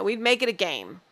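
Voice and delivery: ordinary delivery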